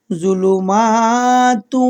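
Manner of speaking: জুলুমাতু chanted very slowly with a nasal ghunna; tajweed demo. Shown as a demonstration. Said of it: "তাহলে এখানে আপনারা যা আছে তাই বলবেন". (জুলুমাতু ধীরে গুন্নাহ সহ টেনে পড়া)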